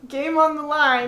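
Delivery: slow, loud dramatic announcer; sports announcer. Pretend. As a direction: hype it like the final play. Dramatic game tension.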